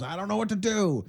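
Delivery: high, soft whimpering dog voice; scared little dog. Retold or imitated. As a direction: imitate a helpless frightened dog.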